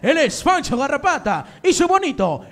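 loud showman intro; announcer voice. Pretend. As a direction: hype up the crowd.

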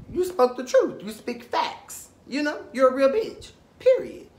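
gas her up, pitch way up on Period; sassy cheer, voice leaping high; hyped praise. Real, not acted. Hyping up Carrie.

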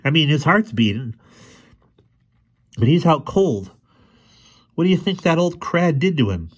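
put-on high, loud worried boy's voice; high loud anxious boy. A narrator's reading. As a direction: fret aloud to the professor. Boy worried over friend.

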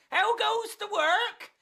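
very high farmer's call. Acted. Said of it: "and he called".

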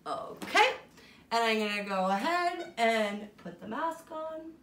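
normal talking voice

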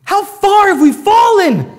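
high, slow, loud grieving cry; Jesus crying out at the corrupted temple; anguished lament. Retold or imitated. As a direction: let it break out in anguish. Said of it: "and he's like".

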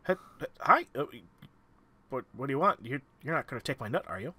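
a lightly voiced nervous squirrel; jittery little critter guarding its nut. GM voicing the squirrel.

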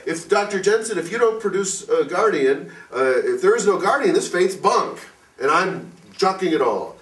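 rile up like a man who's had enough; excited indignant outburst, pitched well up; agitated objection. Retold or imitated. Same man threatening to quit.

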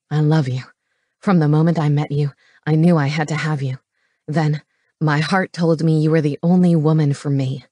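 low-pitched, earnest love confession; low, earnest romantic lead; devoted male lover. Acted. Voices the male lover in narration.

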